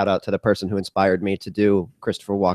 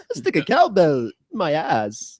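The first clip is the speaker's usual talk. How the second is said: slow, high, drawn-out actor impression; Christopher Walken; 7 semitones higher, slower than usual. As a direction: stretch it out and pitch it up. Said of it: "trying to be Christopher Walken".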